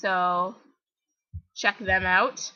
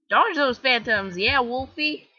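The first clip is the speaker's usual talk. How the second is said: usual speaking voice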